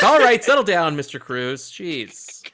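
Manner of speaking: loud start, then a deflating sigh; calm the defendant down, fed up; exasperated court. Retold or imitated. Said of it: "They're like".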